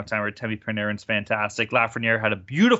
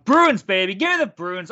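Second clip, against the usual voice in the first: higher and louder, pumped up; hyped cheer; 7 semitones higher, 5 dB louder than usual. Real, not acted. Pumped up backing his team to his cohosts, higher and louder cheer.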